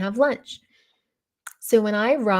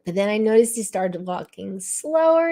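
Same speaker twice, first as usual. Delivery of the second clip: usual speaking voice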